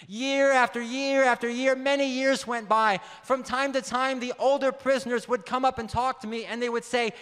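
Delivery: usual speaking voice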